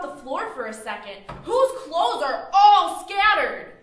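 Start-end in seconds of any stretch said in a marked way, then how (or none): 1.4-3.7 loud, slow accusing question; angry. Acted. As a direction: drag out the accusation loudly. Roommate quarrel escalates.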